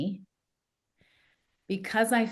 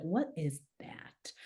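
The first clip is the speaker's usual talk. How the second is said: hushed, slow disbelief; stunned whisper; 9 dB quieter, slower than usual. Retold or imitated. Parents whispering at the news, stunned. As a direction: breathe it out in shock.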